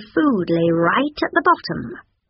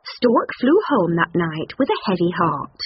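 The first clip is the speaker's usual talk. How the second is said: ordinary delivery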